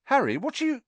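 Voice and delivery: high, startled question; puzzled. A narrator's reading. Friend asking Harry why he stopped, puzzled.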